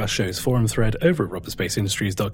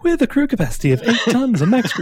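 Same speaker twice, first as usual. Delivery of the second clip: high, loud, laughing American voice; cheery, laughing put-on American voice; sexy American voice; 12 semitones higher, 6 dB louder than usual. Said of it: "this sexy American voice".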